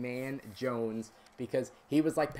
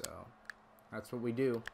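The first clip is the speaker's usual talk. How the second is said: ordinary talk, nothing marked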